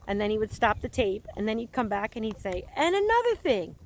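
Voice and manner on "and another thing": high, pushed-up ranting complaint; ranting roommate. Retold or imitated. Retelling a taped rant about a roommate, mock indignant. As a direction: mimic a fed-up guy nagging his roommate. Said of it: "so I sound like that guy right now".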